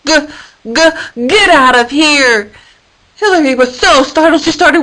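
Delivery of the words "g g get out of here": stuttering bleat into a loud slow yell; goat bleat. A narrator's reading. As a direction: bleat it out then yell, slowly. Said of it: "let out a".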